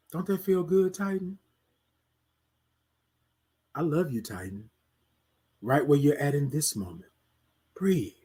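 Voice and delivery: soft, low, tender reassurance; calm yourself down gently and quietly; soothing self-talk. Acted. Speaker comforting self by name, soft low soothing.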